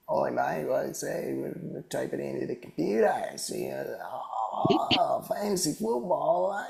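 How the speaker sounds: a rough, high mock Australian accent for a drunk bloke; tipsy Australian lad in a jokey Australian accent. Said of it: "Was that your Australian accent?".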